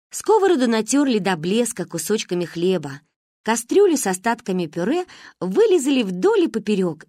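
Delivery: plain reading voice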